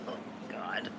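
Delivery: soft exasperated whisper; whispered groan. Real, not acted. Vlogger whispering to herself, giving up on the markers. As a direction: breathe it out, defeated. Still can't find markers.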